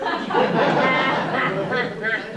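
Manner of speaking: short burst of laughter; loud laugh. Acted. Husband teasing wife after rehearsal, loud laugh. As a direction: guffaw at your own jibe.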